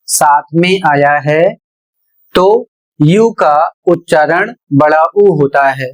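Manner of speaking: normal talking voice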